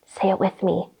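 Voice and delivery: usual speaking voice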